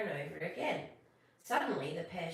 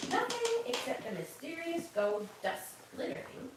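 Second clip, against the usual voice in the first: usual speaking voice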